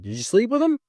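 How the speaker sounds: high, sharp, disbelieving question; pointed question. Retold or imitated. Confronting girlfriend, retold quote.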